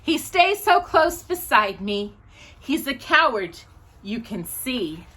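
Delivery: put-on sing-song poem reading for children, lilting; reading a poem aloud. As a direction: read it like a bedtime poem to little kids.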